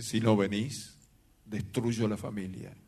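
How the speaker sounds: low, slow menacing threat; hoarse manlike woman's voice. Retold or imitated. Possessed woman threatening the pastor by phone, low slow hoarse menace. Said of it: "una voz de mujer en forma ronca".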